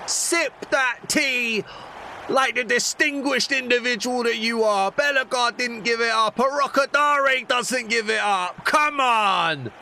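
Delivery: high, loud gloating cheer. Real, not acted. Gamer crowing at the opponent after scoring, loud gloating. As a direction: rub the goal in with a crowing voice.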